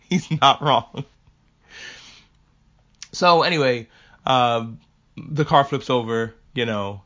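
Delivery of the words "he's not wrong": real laughter breaking up the words, slowly; laughing agreement. Real, not acted. Wife admitting her husband has a point, laughing. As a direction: concede it through helpless laughing.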